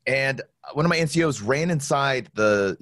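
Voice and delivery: ordinary talk, nothing marked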